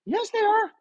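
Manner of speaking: arguing with a doubter about prices, in a mocking high voice. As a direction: play the other guy in a silly high voice. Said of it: "We got two people".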